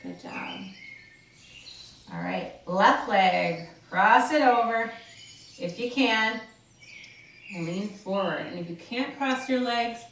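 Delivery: soothing fitness coach; calm exercise instruction. Acted. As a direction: guide them through the stretches calmly. Leads a guided stretch.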